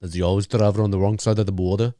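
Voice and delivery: put-on quick, low Austrian-accented movie line; rough imitation of an Austrian accent; attempted Austrian accent. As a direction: try an Austrian action-hero accent. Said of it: "I wish I could do that accent".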